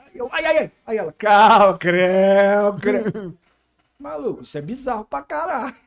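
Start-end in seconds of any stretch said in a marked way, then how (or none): 0.3-0.7 mock pained yelps. Retelling pain during procedure. 1.1-3.3 mock nurse, louder. Imitating nurse calming again.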